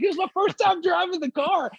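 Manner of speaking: a first-timer proudly explaining himself; clueless rookie. Retold or imitated. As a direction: make him sound naively cheerful. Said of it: "he was like".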